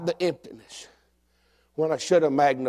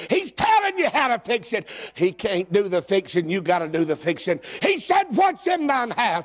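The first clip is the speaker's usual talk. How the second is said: preaching; 4 semitones higher than usual. Acted. Preaching the widow's story.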